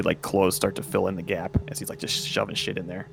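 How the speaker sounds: ordinary talk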